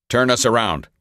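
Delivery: usual speaking voice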